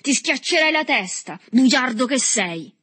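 high, loud insult; threatening shout. A narrator's reading. Boy threatens the liar Berto, shouting. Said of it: "gridò l'altro scuotendo il pugno".

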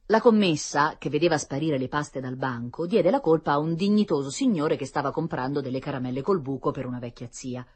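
plain reading voice

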